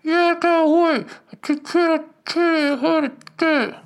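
very high, distorted mock scream; screaming into megaphone. Megaphone girl screaming at the hotel, imitated distorted rant. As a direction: imitate a garbled rant blasted through a megaphone. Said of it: "the girl with the megaphone was just screaming".

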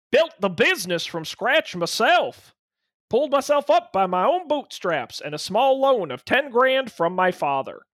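lightly voiced lumberjack, drifting Australian, high; half-hearted Canadian lumberjack accent; gruff booming lumberjack. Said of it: "You an Australian lumberjack?".

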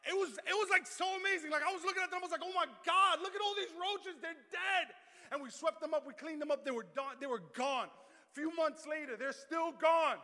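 high, loud, hammed-up jubilation; retold triumph at full volume; amazed shouting. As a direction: shout it like an astonished storyteller. Retells amazement at dead roaches.

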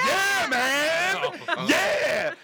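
high, drawn-out mock cheer; gloating victory shout. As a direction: holler it high like a gloating winner. Mimics gloating backgammon winner.

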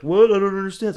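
high, loud feigned confusion; mock clueless coworker. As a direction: imitate a baffled waiter, hammed up. Mimics clueless waiter in rant.

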